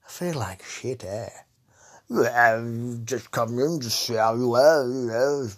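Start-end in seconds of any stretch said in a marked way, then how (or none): 2.1-5.5 louder put-on posh gentleman's voice, slow at first; posh [name]. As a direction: greet them grandly as a plummy toff. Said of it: "old posh fucking [name] here".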